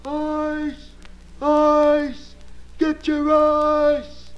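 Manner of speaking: high, loud street cry, drawn out slow; vendor yelling. Acted. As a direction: call it out like a street ice seller. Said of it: "He's yelling at the top of his voice".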